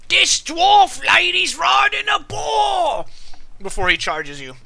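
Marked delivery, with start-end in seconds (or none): 0.1-3.0 very high, slow, drawn-out shout; full-volume acted battlefield yell; attacker's cry. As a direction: holler it out in outraged alarm, stretching the words. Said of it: "immediately he's gonna be like".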